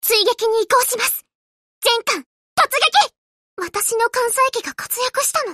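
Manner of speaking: ordinary talk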